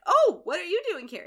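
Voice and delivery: high, loud happy surprise; pleasantly surprised. Acted. Neil unexpectedly running into Emma, pleasantly surprised. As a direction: act out an unexpected run-in, voice jumping up. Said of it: "Neil seems pleasantly surprised".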